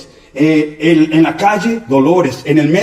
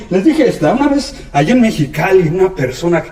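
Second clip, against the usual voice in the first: ordinary talk, nothing marked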